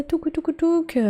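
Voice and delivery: high little tuk tuk; imitated tapping. Real, not acted. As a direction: tap it out high and playful. (petits bruits aigus)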